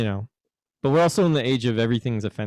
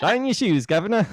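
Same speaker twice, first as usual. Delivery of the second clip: higher, hammed-up British accent on "Shine your shoes, Governor"; fake British accent; 9 semitones higher, faster than usual. Shown as a demonstration. Demonstrating his accent-learning product to a friend, hammed-up British accent. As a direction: push the cockney, "Governor" rising at the end. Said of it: "you'd be like".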